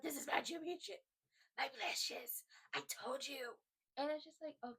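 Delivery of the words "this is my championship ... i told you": high, strange put-on voice, unhinged; crazed possessive champion. Fan mimicking champion obsessed with his belt, crazed and high. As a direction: hoard it like a mad, jealous creature.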